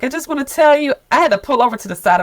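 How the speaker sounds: eager news. Retold or imitated. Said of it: "she was like".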